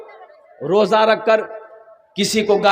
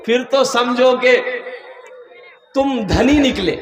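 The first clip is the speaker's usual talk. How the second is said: ordinary talk, nothing marked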